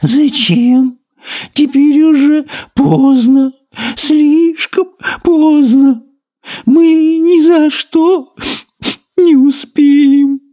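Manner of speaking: very high, slow doomed snail voice. A narrator's reading. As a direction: moan it as a hopeless snail. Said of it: "обречённо опустила выпуклые глазки".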